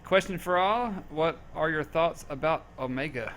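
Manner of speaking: usual speaking voice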